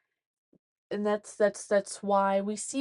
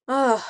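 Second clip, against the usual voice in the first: loud, worn-out "ugh"; exasperated groan; 2 semitones higher, 8 dB louder than usual. Real, not acted. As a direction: groan it out, mentally exhausted. Overwhelmed, out of thoughts.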